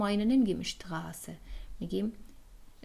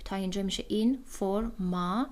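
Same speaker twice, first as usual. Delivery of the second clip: ordinary delivery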